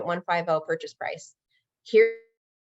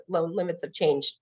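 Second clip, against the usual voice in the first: blank echo. Retold or imitated. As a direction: mumble "loan limits have changed" back as if it means nothing. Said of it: "They're like".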